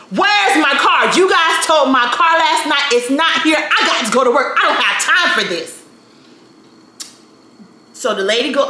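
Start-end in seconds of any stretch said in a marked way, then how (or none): 0.1-5.8 higher-pitched, worked-up confrontation; angry demand. Retold or imitated. Resident confronting staff over her towed car, angry demand. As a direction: lay into the staff in acted anger. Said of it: "I am angry".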